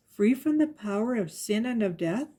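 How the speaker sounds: ordinary delivery, nothing marked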